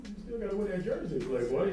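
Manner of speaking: teasing reminder. Retold or imitated. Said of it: "And I was like".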